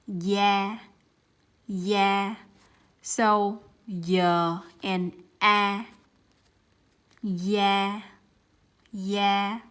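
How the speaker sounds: "yeah" for da, y sound with falling tone; Vietnamese da. Shown as a demonstration. Teacher modelling the word for skin. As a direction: say "yeah" slowly and clearly. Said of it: "we have an example here".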